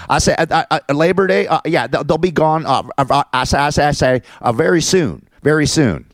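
high, halting cartoon rooster bluster; mock supervisor as stuttering rooster; Foghorn Leghorn. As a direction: stall and stammer like a cartoon rooster. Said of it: "I call him Foghorn Leghorn".

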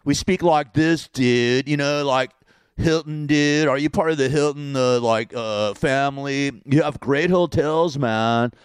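hammed-up San Fernando Valley talk; exaggerated California dude accent. Shown as a demonstration. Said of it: "we talk like this in California".

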